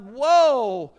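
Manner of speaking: a loud burst of surprise, retold; whoa, this is exciting, hammed up; suddenly thrilled at the gas pump, retold; excited whoa. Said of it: "and I thought".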